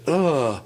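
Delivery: loud high straining grunt; effort grunt. Retold or imitated. Girlfriend hitting herself with a bottle, loud high straining grunt. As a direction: grunt as you swing the bottle hard. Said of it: "And she's like".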